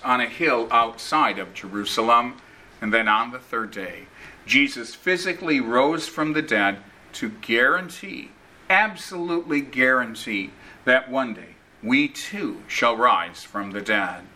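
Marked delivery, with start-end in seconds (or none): none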